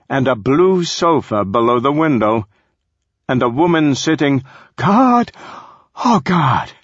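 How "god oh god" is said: overwhelmed cry. Acted. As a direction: pitch jumps much higher on a stunned God. Marco overwhelmed seeing her.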